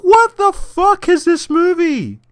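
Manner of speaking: high, slow stunned question; slow disbelief. Retold or imitated. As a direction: drag it out in disbelief. Reacting to the movie.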